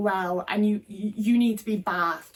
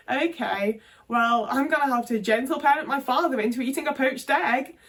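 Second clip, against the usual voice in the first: gently patronise a parent about his diet; high coaxing carer voice nagging a father about junk food; patronising carer voice; 4 semitones higher than usual. Retold or imitated. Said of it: "being like".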